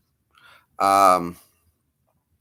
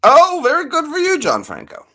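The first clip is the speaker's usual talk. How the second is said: character voice; 4 dB louder than usual. Acted. Gravelly character voice to chatter.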